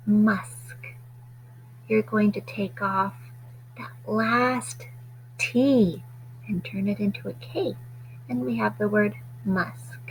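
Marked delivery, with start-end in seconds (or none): 0.1-0.8 Musk whispered each time, no voice. Shown as a demonstration. Teacher whispering the new word to the class, whispered. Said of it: "To make musk". 3.8-7.8 breathy unvoiced aside; whispered. Shown as a demonstration. Teacher whispering a hint to pupils, whispered. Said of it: "teacher whispering aside".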